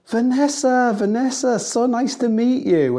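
high, loud, drawn-out gushing hello; gushing celebrity greeting. Retold or imitated. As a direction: greet her like a delighted star host. Bear Grylls greets wife warmly.

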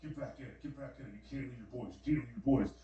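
soft, urgent self-talk; talking to himself. Retold or imitated. Dreamer urging himself back into the nightmare, talking to himself. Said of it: "I remember even talking to myself".

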